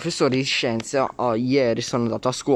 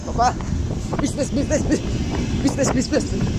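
high, eager calling out; excited calling; 12 semitones higher, faster than usual. Real, not acted. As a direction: call out to dad, thrilled. Child calling to dad excitedly.

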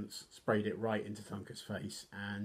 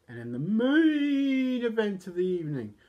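loud, high announcer call stretching MAIN; hammed-up fight-night introduction; ring announcer; 11 semitones higher, 10 dB louder than usual.

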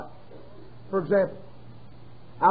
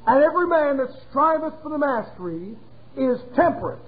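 scripture reading; 4 semitones higher than usual. Acted. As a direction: read the verse like holy writ. Reads scripture aloud.

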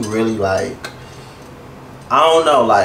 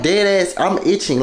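ordinary talk, nothing marked

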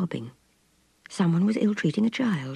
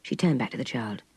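plain reading voice

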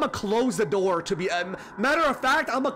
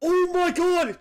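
excited; 6 semitones higher than usual. Real, not acted. Discovers new game.

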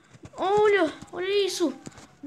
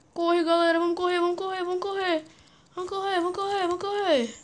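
panicked urging; 6 semitones higher, faster than usual. Real, not acted. Gamer urging viewers to run from the bug, panicked. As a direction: hurry the viewers to flee.

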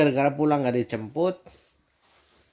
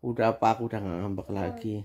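soft voice; 5 semitones lower, 7 dB quieter, faster than usual. Shown as a demonstration. Dad modelling for daughter how to say it softly, meek and hushed. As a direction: lower and softer, fast, meek. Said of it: "suaranya harus pelan dong".

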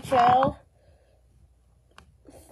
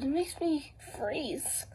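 whispering; 13 dB quieter, slower than usual. Real, not acted. Complaining about the cold outside.

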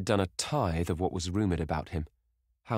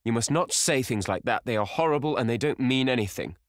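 louder, urgent protest; crying out; 7 semitones higher, 6 dB louder than usual. Acted. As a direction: protest hotly. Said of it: "he cried".

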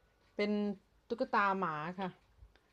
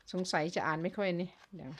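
ordinary talk, nothing marked

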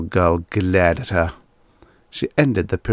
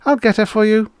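high, lightly voiced young woman; high, louder front-row lady; drawling young woman; 14 semitones higher, 5 dB louder, faster than usual. A narrator's reading. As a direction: offer it in a high feminine voice. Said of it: "A young woman in the front rank drawled".

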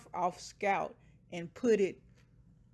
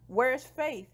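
higher-pitched urgent question; mildly urgent, acted-out demand; 5 semitones higher, 4 dB louder than usual. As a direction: ask urgently, a little raised. Labor begins, she asks urgently.

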